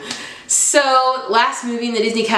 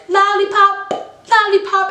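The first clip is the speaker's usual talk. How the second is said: squeaky cartoon voice; 14 semitones higher than usual. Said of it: "her and Runt are like".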